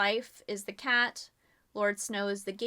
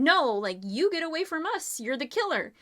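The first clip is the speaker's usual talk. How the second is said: loud, higher, angry denial; accusing; 6 semitones higher, 3 dB louder than usual. Acted. The group accusing James as he approaches, angry and loud. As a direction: push him away with the words. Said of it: "they're all accusing him".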